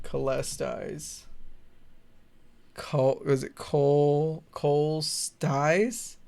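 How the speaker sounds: cholestize sounded out slowly, syllable by syllable; slow unsure reading of cholestize; puzzled sounding out. Real, not acted. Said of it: "What is this word?".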